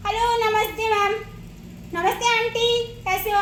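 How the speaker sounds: put-on lively, chatty child's hello; cheery greeting. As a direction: bounce in brightly with a hello. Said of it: "कई बोलते हैं".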